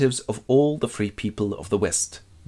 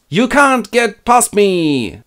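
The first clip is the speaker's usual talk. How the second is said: high, slow, loud defiant cry; Gandalf; 11 semitones higher, 6 dB louder, much slower than usual. Acted. Wizard faces fire demon, high defiant shout. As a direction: block the way with a drawn-out challenge. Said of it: "Gandalf goes".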